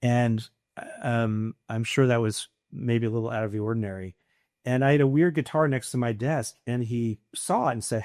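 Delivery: ordinary talk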